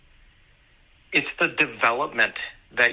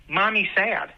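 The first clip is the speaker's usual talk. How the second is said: higher-pitched imitation of a toddler; 6 semitones higher than usual. Toddler running over with a tissue for mom, lightly imitated toddler voice. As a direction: lightly mimic a little child talking. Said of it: "in that toddler voice".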